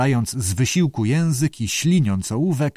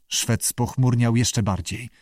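plain reading voice

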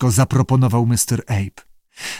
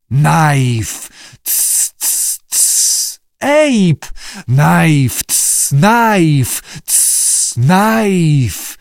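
hissing then barking calls; whisper yelling; 3 semitones higher, much slower than usual. A narrator's reading. Newts hiss and bark together.

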